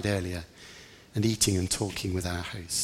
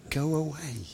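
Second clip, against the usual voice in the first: higher but softer brush-off; firm dismissal; 6 semitones higher, 6 dB quieter than usual. Acted. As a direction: send her off with restrained impatience. James dismisses the woman.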